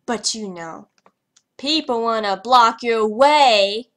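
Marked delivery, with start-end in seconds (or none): 1.6-3.9 loud, slow complaint at players in the way; complaining loudly to the players blocking her; annoyed. Real, not acted.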